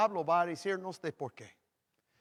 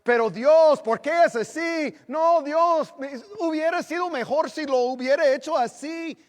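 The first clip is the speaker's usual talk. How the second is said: loud, high whining complaint to God; complaining to God; 12 semitones higher, 12 dB louder than usual. Retold or imitated. Grumbler questioning God about his plan. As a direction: whine it up at heaven, loud. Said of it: "empezamos de decir a Dios".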